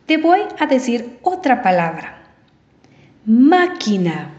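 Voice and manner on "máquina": "Máquina" slowly, with MÁ emphasized; stress on first syllable. Shown as a demonstration. Teacher giving another word to test, "Máquina" stressed on MÁ. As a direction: lean on the opening MÁ of "Máquina". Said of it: "¿Dónde lleva la fuerza de voz?".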